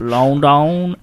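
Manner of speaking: London drawn out slowly with long, round vowels; joke mispronunciation. Pretend. Listing trip stops for co-hosts, London jokily mispronounced and drawn out. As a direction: stretch London into a silly foreign-sounding word. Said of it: "You wanna say it normally?".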